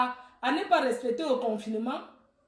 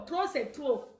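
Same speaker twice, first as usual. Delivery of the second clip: softer, dropped-back protest; subdued repeat; 7 dB quieter than usual. Real, not acted. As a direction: let it fall away softly. Repeats slogan, softer second take. (répétition plus basse, retombée)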